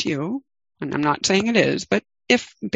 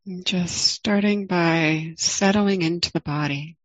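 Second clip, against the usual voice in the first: guided meditation. Acted. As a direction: ease listeners into the body, gently.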